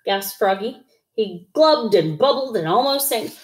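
ordinary delivery

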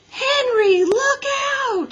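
high, loud warning scream. A narrator's reading. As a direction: yell it as a sharp warning. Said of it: "screamed Miss Roop".

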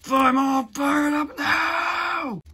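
high, loud, slow battle roar; fired-up shout. Acted. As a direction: bellow it, stretching every word.

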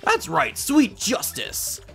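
triumphant. Acted. Game character cheering a win.

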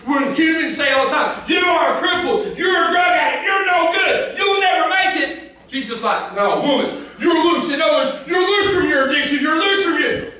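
high, loud preaching shout; full-force preaching. Acted. Preacher shouting to the congregation at full force. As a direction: preach it out to the crowd.

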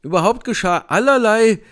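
ordinary delivery

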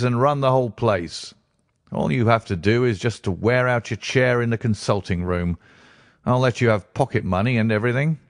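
a hint of a blunt businessman questioning the doctor; brisk stranger. A narrator's reading. Blessington voiced in read story.

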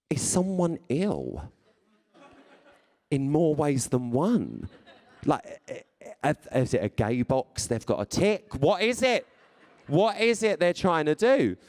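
high-pitched baffled questioning; mock incredulity. X Factor reject offered a Radio two show, mock incredulous. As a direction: question it in comic disbelief, voice climbing.

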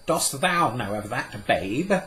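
put-on old scholar amazed at a child; cried out in wonder. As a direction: cry it out in wonder. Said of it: "he cried".

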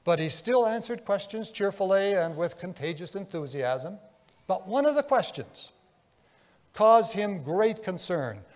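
ordinary talk, nothing marked

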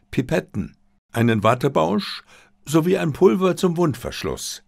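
usual speaking voice

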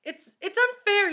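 high, loud outburst of protest; upset shout. A narrator's reading. Damien protesting his teacher leaving, shouting. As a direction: shout out at the injustice, stumbling on the start. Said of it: "he shouted".

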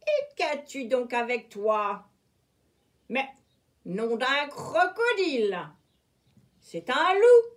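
pirate discovering a wolf in his net; pirate surprised. A narrator's reading. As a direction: end in a shocked cry. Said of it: "jubila un affreux pirate".

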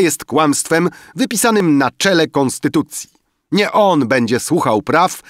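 high, loud banquet oratory; rousing toast. A narrator's reading. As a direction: proclaim it to the whole table, high and loud. Banker's loud banquet toast speech. (podniosła, głośna przemowa przy toaście)

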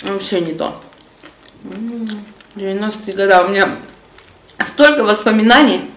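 speaking between bites; chewing while talking. Real, not acted. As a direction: mouth partly full of food. Seen 3: Ate spoonfuls before and mid-phrase; jaw working, mouth partly full.

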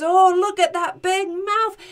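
high, loud delighted exclaiming; amused exclamation. Acted. Giant amused by the baby's mouth, exclaiming. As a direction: crow over it in amusement. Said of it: "and Cuchulainn laughs".

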